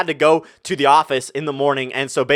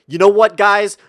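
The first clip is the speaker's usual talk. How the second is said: loud challenge; 4 semitones higher, 6 dB louder, slower than usual. Retold or imitated. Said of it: "and I'm like".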